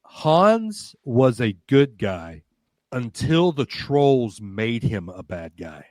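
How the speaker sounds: drawn-out reveal, noticeably slow; slow, deliberate reveal. Retold or imitated. Staff member slowly revealing a secret theory, slow deliberate delivery. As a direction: lay out the theory slowly, word by word. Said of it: "she said".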